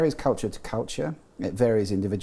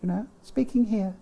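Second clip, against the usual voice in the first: put-on elderly lady falsetto; 8 semitones higher than usual. To the host, demoing falsetto register: much higher elderly lady. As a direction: coo it like a gentle elderly lady. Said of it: "elderly lady".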